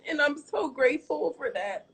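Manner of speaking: talking through tears, voice high and breaking; crying. Real, not acted. Streamer tells viewers about her year, crying. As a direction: push the words out while sobbing. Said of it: "I hate crying".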